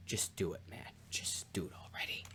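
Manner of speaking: low, very soft near-whisper; hushed urging. Retold or imitated. Lady Macbeth pressing her husband to act, hushed. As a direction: drop to a whisper as you push him.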